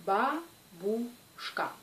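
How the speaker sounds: "Бабушка" split slowly into ба, буш-ка with gaps; syllable by syllable. Shown as a demonstration. Teacher counting three syllables for learners, "Бабушка" slowly broken apart. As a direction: break "Бабушка" into slow separate syllables. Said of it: "donc trois syllabes".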